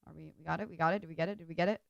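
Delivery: normal talking voice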